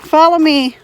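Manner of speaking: calling chickens. Real, not acted. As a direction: coax the hens along.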